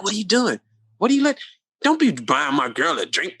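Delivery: hammed-up jealous boyfriend snapping; imitating angry ex. Ex-boyfriend mocked scolding her for a drink, angry. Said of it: "He was so pissed".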